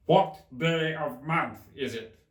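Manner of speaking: drawn out and loud like the Hatter. Acted. Hatter remarking on Alice's hair, slow and loud.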